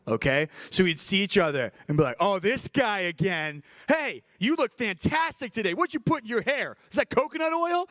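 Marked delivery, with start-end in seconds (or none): 2.2-7.9 much higher, sing-song fake compliments; playful over-the-top flattery to a rival; mock flattering. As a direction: gush sarcastically over his hair. Compliment insult battle.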